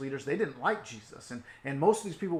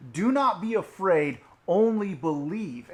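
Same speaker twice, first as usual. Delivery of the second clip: louder, slow firm command; firm reassurance; 4 semitones higher, 9 dB louder than usual. Acted. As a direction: reassure him firmly, slowing at the end. Jesus reassures the grieving father.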